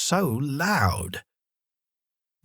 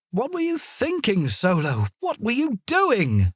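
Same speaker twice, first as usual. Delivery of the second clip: scolding yell; 11 semitones higher than usual. A narrator's reading. Mum scolds Solo after he ran into the fire, mock angry. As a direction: tell the dog off in relieved anger. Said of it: "she yelled".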